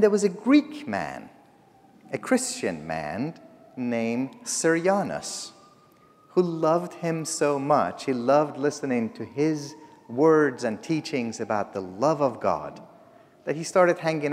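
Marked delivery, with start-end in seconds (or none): none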